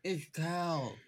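talking through a mouthful; mouth full. Acted. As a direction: say it round a big bite. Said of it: "through a very full mouth".

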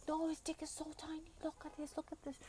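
high, small-volume mock squeal; imitated excited squeal. Friend showing off the movie, imitated soft squealing. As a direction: mimic an excited friend urging everyone to look.

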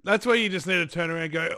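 ordinary talk, nothing marked

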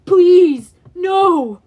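loud, high monster plea; pitiful moan. A narrator's reading. Cyclops begs for his life, pitiful moan. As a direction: wail for mercy like a beaten giant. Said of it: "the Cyclops moaned pitifully".

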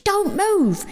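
very high shrieking command; screech. Acted. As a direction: screech it out sharply. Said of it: "she screeched".

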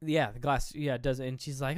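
ordinary talk

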